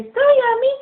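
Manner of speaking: sing-song. Shown as a demonstration.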